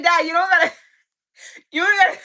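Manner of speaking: high, loud plea breaking into laughter; laughing plea. Retold or imitated. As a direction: laugh through the begging.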